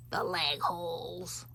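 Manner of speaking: "The leg holes" slow and drawn out in an odd put-on voice; funny drawn-out voice. Shown as a demonstration. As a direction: stretch "The leg holes" in a silly voice. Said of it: "Why do I talk like that sometimes?".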